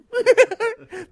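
loud, high burst of laughter; amused laugh. Real, not acted. Veteran after mimicking the lieutenant's French, amused laugh. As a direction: laugh out loud at your own impression. Amused by his own imitation.

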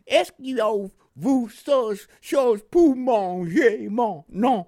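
put-on slow, loud, high Mississippi-accented French; French with Southern accent. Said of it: "in his French Southern accent".

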